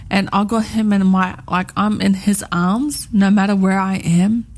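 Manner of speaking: ordinary talk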